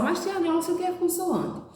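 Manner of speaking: put-on high childlike voice puzzled; pretend pupil asking. Teacher voicing a child asking about consonants, high and childlike. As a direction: ask like a confused little pupil. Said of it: "Então, olha só".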